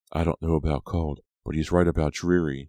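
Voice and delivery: usual speaking voice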